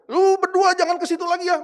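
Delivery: high, loud retold telling-off; angry scolding. Father warning the two of them at the table, angry telling-off. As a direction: imitate a cross father's warning. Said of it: "Dia masih marah-marah".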